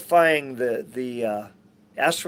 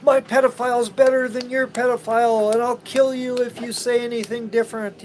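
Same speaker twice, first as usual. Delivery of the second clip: mocking partisan; 9 semitones higher, 4 dB louder than usual. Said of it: "all you people who worship one politician".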